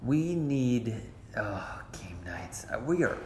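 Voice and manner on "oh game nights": whispering. Real, not acted. Dismayed aside about game nights.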